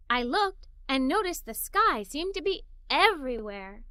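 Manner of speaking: ordinary delivery, nothing marked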